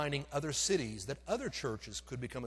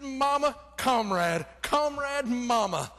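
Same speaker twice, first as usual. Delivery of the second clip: louder, higher, happy repeating; joyful Mama comrade; 7 semitones higher, 9 dB louder, slower than usual. Retold or imitated. Grateful son handing over his mother, loud and happy. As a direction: cheer each word like a grateful son.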